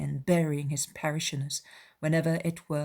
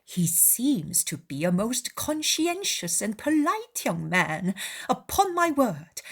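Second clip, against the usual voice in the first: high-pitched, mock-approving father; wry Mr Bennet; 8 semitones higher than usual. Father commenting on the clergyman's letter, drily mock-impressed.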